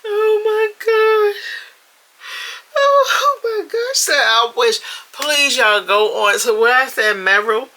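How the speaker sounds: high, loud, laughed-to-tears voice; in shambles. Real, not acted. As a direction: squeal it while recovering from helpless laughter. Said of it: "These comments got me in shambles".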